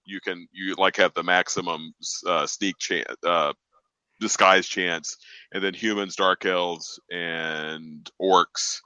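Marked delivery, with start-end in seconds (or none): none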